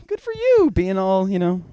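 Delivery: very high, squeaky praise to a pet; high-pitched pet talk; cooing praise. Acted. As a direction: gush to the dog in a tiny high voice. Cooing praise at sleeping dog.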